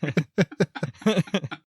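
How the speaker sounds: breaking into laughter, high and loud; laughing. Real, not acted. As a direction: crack up mid-quote. Laughing while repeating quote.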